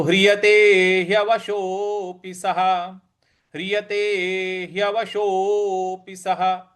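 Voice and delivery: slow, careful verse line; teacher reciting for students to repeat; slow model recitation. Shown as a demonstration. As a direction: recite the half verse slowly. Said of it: "इस प्रकार से बोलेंगे".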